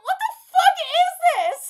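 high, loud laughing disbelief; real incredulous outburst; baffled shout. Real, not acted. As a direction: yell it, utterly baffled and amused. Baffled reaction to the scene.

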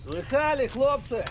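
loud, high taunt; mocking call. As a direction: show off to the boys. Taunting his men.

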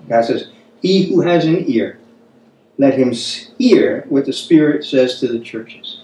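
ordinary delivery

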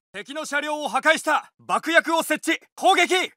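ordinary delivery

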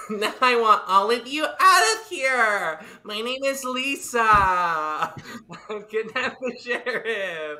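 loud, high, laughing villain rant; yelling villain. Acted. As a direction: shout the demands through laughter. Player yells as Lisa villain.

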